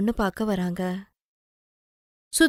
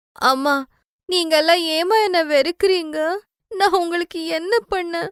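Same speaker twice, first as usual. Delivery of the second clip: put-on much higher, wounded girl's plea; hurt pleading girl; 8 semitones higher than usual. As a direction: ask why they hate you, near tears. Sudha pleads with family. (மிக உயர்ந்த குரலில் வேதனையுடன் கெஞ்சும் பெண்)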